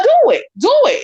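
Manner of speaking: high-pitched, goading challenge; defiant dare. Real, not acted. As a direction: dare them, pitch jumping up. Daring opponent in a quarrel.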